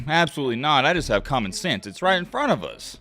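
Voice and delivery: normal talking voice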